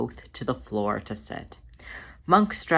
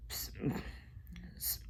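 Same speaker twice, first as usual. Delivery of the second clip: stammering, tearful sorry; lower, broken, weepy stutter; choked apology; 4 semitones lower, 16 dB quieter than usual. Acted. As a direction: choke it out between sniffles. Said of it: "Monk managed to choke between sniffles".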